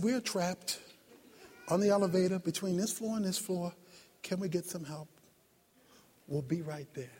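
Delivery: low, hushed, matter-of-fact phone call; calm. Retold or imitated. Calm man in the stuck elevator phoning for help, low and composed. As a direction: report it quietly, with no panic at all. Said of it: "There was one calm man on the elevator".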